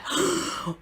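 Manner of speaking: sharp mock gasp of disbelief; shocked gasp. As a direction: gasp as if hearing terrible news. Shock at cancelled flight.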